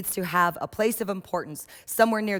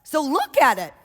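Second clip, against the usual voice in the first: put-on high, pushy little kid begging for attention; little kid insisting over and over; eager child; 10 semitones higher, 6 dB louder than usual. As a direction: play an excited child tugging at a grown-up. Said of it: "the child comes up to you and says".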